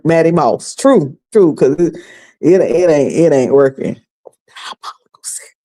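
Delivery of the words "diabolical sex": breathy mock whisper of the phrase. Host echoing the phrase in a mock whisper. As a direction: hush it like a secret.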